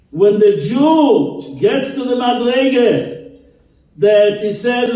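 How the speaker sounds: high, loud, fervent sermon climax; preaching at full force. Acted. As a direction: build it up like a rabbi driving home the lesson.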